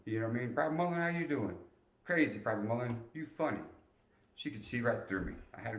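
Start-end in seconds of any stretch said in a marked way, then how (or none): 0.5-3.6 high, louder sweet wife voice; light caring lady's voice, lifted high; sergeant's wife. Retold or imitated. Sergeant's wife checking on the young private, high and sweet. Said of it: "wife greeting him".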